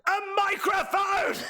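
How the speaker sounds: high shouted mock answer; mock shriek. Blinded wrestler demanding a microphone, a high hammed-up shriek.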